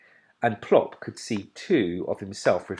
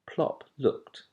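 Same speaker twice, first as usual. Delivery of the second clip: plain reading voice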